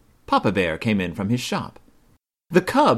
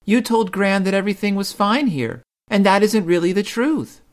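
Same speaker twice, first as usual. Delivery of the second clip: put-on childish protest; 11 semitones higher than usual. A narrator's reading. Sister Bear protesting to Mama, insistent. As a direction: object like a puzzled child. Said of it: "protested sister".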